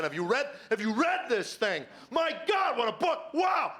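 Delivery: high, exuberant raving; hammed-up, high-pitched amazed outburst; excited shouting. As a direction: rave to the whole neighbourhood. Wanting to shout it from lawn.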